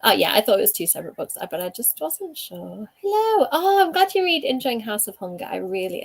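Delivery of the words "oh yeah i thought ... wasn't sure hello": ordinary talk, nothing marked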